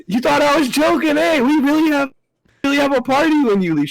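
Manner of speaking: teasing comeback. Retold or imitated. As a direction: crow it back at the boss. Retold teasing shout back.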